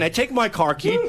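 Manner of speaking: normal talking voice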